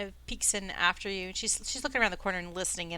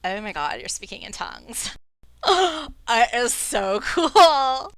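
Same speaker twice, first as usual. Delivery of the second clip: awed gush; 2 semitones higher, 6 dB louder than usual. Acted. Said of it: "She's like".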